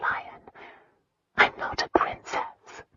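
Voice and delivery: soft forlorn whisper. A narrator's reading. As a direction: confess it in a whisper. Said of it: "She'd whispered the confession".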